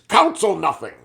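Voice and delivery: retold furious dismissal; scream. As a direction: snap it back at the captains. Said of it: "And he screams".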